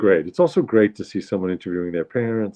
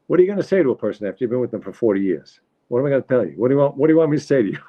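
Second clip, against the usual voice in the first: rapid, higher-pitched New York husband impression; exasperated father; 5 semitones higher, much faster than usual. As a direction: fire back as the put-upon husband. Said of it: "he says, uh".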